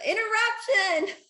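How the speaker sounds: high, loud, drawn-out playful call; playful interjection. Pretend. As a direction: call it out jokingly, stretched and high. Mock interruption, then laughter.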